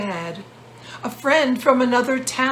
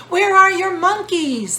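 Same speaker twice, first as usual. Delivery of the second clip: put-on high, loud child's voice; eager kids calling out the question; children asking; 10 semitones higher, 6 dB louder than usual. Four children asking the peddler, high and loud. They asked.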